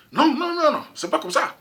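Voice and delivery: emphatic no. Real, not acted. Rejecting advice to leave home.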